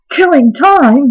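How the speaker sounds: loud furious dog roar. Acted. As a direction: roar it in disbelief. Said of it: "roared the dog so furiously".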